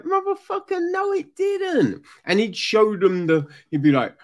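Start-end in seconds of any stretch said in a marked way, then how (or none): 0.0-2.0 high-pitched indignant retort; imitating his own outraged reply; mock outrage. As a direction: squeal it in disbelief. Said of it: "I'd be like".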